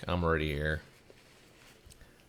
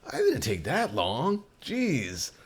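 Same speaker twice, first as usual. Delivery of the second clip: higher, slow put-on whine; mock cashier complaint; 7 semitones higher, slower than usual. Cashier defending himself, high slow mock voice.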